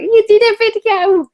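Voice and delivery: very high, loud squeal of praise; parents' excited voice. Retold or imitated. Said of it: "that's how both of them sound".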